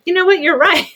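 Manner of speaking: put-on a cheerful surrender to the kid; mock conceding. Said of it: "And I'm like".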